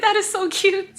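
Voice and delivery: over-the-top squeal of delight; squealing gush. Retold or imitated. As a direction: shriek it like you can't handle the cuteness. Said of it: "I was like".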